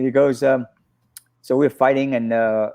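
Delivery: normal talking voice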